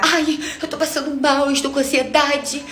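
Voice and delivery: high, whiny imitation of the friend crying. Cheating friend confessing on the phone, a high mock-tearful whine. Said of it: "E aí ela começou a chorar".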